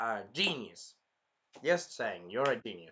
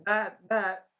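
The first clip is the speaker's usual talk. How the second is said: higher, louder stuttered alarm; mock alarm; 6 semitones higher, 6 dB louder than usual. Raftalia alerting Naofumi, mock-alarmed imitation. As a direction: blurt it out like a startled girl. Acting alarmed girl pointing.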